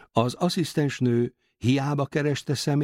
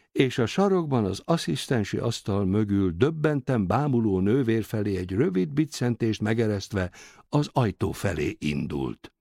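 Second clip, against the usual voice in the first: usual speaking voice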